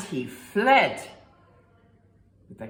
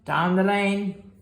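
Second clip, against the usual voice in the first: ordinary delivery, nothing marked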